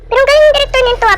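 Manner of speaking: words tumbling out fast, scared; rushed nervous chatter. Real, not acted. Gamer spooked by creepy sound effects, talking fast. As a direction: rattle it off quickly, nerves showing. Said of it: "Takot na takot na ako".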